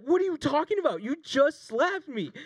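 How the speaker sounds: high, loud, exasperated; indignant disbelief. Retold or imitated. Defending himself to Tony, high exasperated protest. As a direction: protest like you can't believe it. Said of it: "And I was like".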